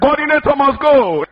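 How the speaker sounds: high-pitched protest cry; agitator's shout. Acted. Preacher acts out church agitators shouting for removal, high protest cry. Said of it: "somebody will shout there".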